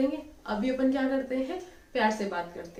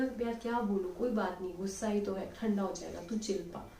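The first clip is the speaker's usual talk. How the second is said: calm soothing reply; 3 semitones lower, 6 dB quieter than usual. Retold or imitated. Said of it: "बोलेगा".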